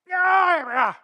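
loud, high wordless yell; hammed-up scream of rage; mock angry roar. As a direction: let out a pretend furious holler. Demonstrating an angry yell while seated.

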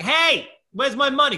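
high, loud retold call. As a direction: shout after someone walking off, mock indignant. Calling after man walking away.

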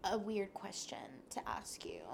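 hushed, nervous whisper; whispered nervous question. Retold or imitated. Stranger nervously asking her about a photo, whispered.

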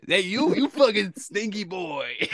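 high, slow, teasing holler; mock taunting shout. [name] teasing Antonio in the bathroom, slow high mock shout. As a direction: draw out the taunt as you call it.

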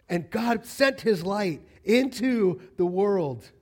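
loud, high rising preacher call; the preacher urges the church, loud and high; full-force preaching. Acted. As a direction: preach it out to the crowd.

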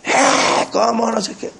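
high-pitched protest at the players; strained mock yell, trailing away; mock fan yelling. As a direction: imitate a fan heckling the TV. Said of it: "le grita a los jugadores".

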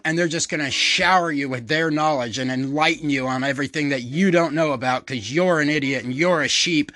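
ordinary talk

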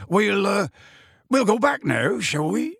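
high, halting character voice; hesitant high question; Hagrid awkwardly suggesting. Acted. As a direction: propose leaving, unsure of yourself.

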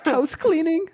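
put-on very high, loud Mexican maid accent; hammed-up maid accent, calling out at the door; mock maid accent. Podcaster mocking his own failed accent, high Mexican maid voice. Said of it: "I sound like I should be saying".

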